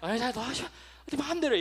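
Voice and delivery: 될 대로 되라는 듯 높여 내던지는 말투 흉내; 포기한 듯 네 마음대로 하라고 툭; 자포자기. Retold or imitated. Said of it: "자포자기할 때 그 말". (자식에게 지쳐 포기하듯 내뱉는 부모)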